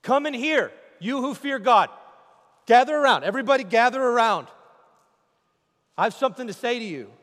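loud, high summons to the crowd; preacher's call. Acted. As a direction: call them in like a revival preacher. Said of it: "he turns around to you and he says".

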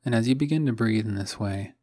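calm meditation voice with long, restful pauses; guided meditation. Acted. Host guides listeners waking up to follow the breath, calm meditation voice. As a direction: guide them gently, letting each phrase settle into silence.

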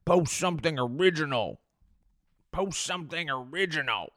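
high, sneering know-it-all voice; a nasal-free whiny put-down; mock troll commenter. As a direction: mimic a smug critic. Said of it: "they're like".

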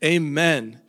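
loud, lifted acclamation of God; exultant doxology. Acted. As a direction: proclaim it as Paul breaking into praise. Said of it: "pauses to reflect, and he says".

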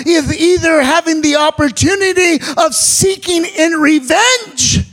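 sermon cadence building to a cry; full-force preaching. Acted. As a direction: preach it to the whole church.

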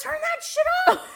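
high-pitched mock-husband yelling; retold angry yell. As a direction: imitate a husband at the end of his patience. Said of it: "We're yelling at each other".

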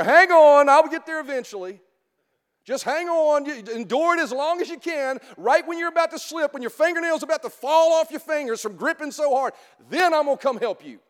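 loud, high mock yell from far above; mock yelling God. God yelling down to a dangling believer, mock shout. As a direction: call down the caller's lines in a hammed-up shout. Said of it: "He's yelling down there".

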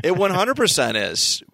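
ordinary talk, nothing marked

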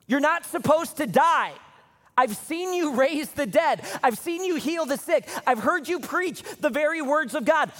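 high, loud desperate cry to Jesus; John pleading at the cross; anguished plea. Acted. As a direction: beg through disbelief. John's anguished plea imagined.

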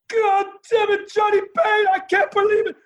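high, shouted scolding; furious yell. Retold or imitated. Iron Sheik yelling at Johnny after they walk out, furious. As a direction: bawl him out, voice way up. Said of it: "He was so mad".

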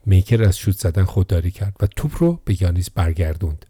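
usual speaking voice

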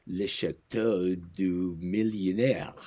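put-on slow, playful French accent; hammed-up French for a fancy restaurant name. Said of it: "That was your French accent?".

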